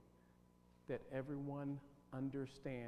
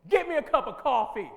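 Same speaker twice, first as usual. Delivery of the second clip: loud, rude command for coffee; demanding order; 10 semitones higher, 18 dB louder than usual. Shown as a demonstration. Teacher demos a rude, loud coffee order. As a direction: bark the order like a boss. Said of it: "now watch this one".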